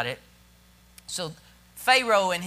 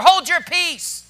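raised, slow, scolding order; blunt command; 8 semitones higher, 7 dB louder, slower than usual. Acted. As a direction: order the complainers to be silent, firmly. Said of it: "You know what He was saying?".

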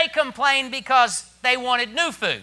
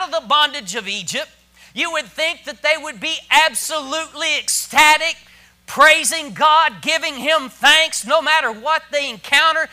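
loud, raised, emphatic sermon delivery; full-force preaching; 5 semitones higher, 8 dB louder than usual. Real, not acted. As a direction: proclaim God's deliverance to the congregation.

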